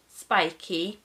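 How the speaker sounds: ordinary delivery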